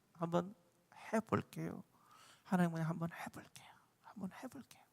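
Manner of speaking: soft, then a whispered prayer; fading to a whisper. Pretend. Preacher voices a humble believer's prayer, softening into a whisper. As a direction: let the vow fade into a hushed whisper.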